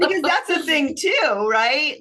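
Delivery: a real laugh breaking into the line. Real, not acted. Still laughing after her remark.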